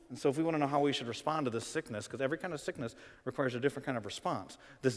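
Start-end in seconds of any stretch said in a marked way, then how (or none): none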